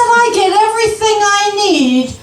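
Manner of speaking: high, insistent boast, imitated; mock-indignant retort, starting high and settling; indignant husband. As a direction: play a husband bragging indignantly about his thrift.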